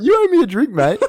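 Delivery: very high-pitched teasing imitation; hammed-up insistence; mock demand. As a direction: mimic a pestering drunk mate. Friend mimics speaker demanding a drink.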